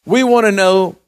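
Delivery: full-force preaching. Acted. Preacher exhorts the congregation that the blood is enough, full force. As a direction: drive it home to the congregation.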